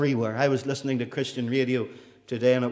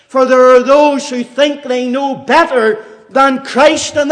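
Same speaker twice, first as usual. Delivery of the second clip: loud fiery preaching; 13 semitones higher, 13 dB louder than usual. Acted. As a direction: thunder it from the pulpit. Preaching at full force.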